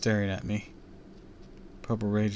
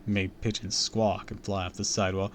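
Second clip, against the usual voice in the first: ordinary delivery, nothing marked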